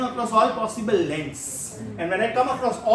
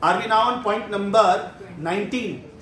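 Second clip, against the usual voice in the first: ordinary talk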